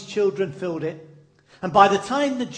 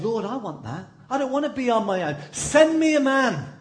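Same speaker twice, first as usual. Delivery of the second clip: rising higher and louder to a plea; pleading prayer; 5 semitones higher, 3 dB louder than usual. Retold or imitated. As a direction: beg God like a lonely woman. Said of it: "And she said".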